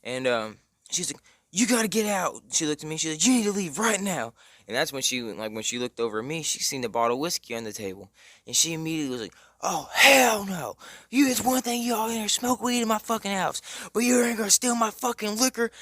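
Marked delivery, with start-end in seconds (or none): 1.5-2.4 higher, urgent retold order; mock angry mom. Friend's mom ordering the boy out, mock angry. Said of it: "she's immediately screaming". 3.2-4.3 higher retold demand; mock angry mom. Friend's mom telling the guest to leave, mock angry. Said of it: "she's immediately screaming". 9.6-15.7 high-pitched, indignant mock tirade; pissed-off mom. Mom spotting her whiskey on the table, mock pissed off. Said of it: "she's pissed".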